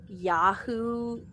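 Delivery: normal talking voice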